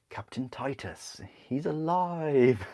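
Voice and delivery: slow, booming, rising theatrical proclamation; hammed-up hearty actor's roar; Brian Blessed. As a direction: stretch each word like a grand Shakespearean bellow. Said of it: "Brian Blessed as a Tyranids".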